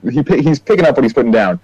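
normal talking voice

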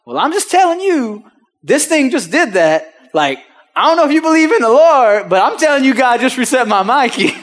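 high, loud, hammed-up protest; emphatic insistence. Customer convincing doubting dealership of a miracle, loudly insistent. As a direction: insist on the miracle, half laughing by the end.

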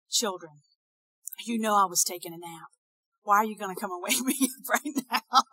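mock long-suffering parent disturbed mid-nap; gently exasperated, breaking into a laugh; weary parent woken up. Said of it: "you saw in my response".

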